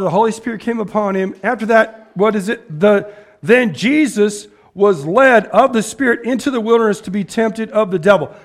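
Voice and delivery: usual speaking voice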